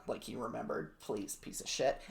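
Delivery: low, disgusted aside; scornful mutter. Real, not acted. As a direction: mutter it with contempt. Scornful aside about Jackson.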